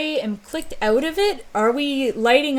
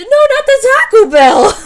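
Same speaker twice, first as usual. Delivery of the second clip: high, loud, hammed-up wail of despair; mock horror; 13 dB louder than usual. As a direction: scream it like it's the end of the world. Acted character horror, Taco Bell.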